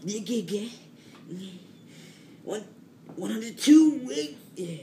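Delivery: put-on high, loud kid counting sit-ups; excited counting. Third grader counting sit-ups in gym class, high and hyped.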